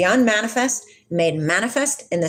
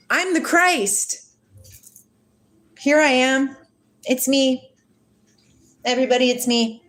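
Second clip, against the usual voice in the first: higher, showy self-proclaiming; mock false messiah; 5 semitones higher than usual. Mocking how the world hears a false Christ, showy. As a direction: ham up a false Christ announcing himself. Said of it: "somebody says".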